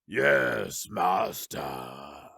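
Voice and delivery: low, deep gravelly monster reply; rumbling obedient brute, gravelly throughout. A narrator's reading. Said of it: "in a deep, gravelly voice".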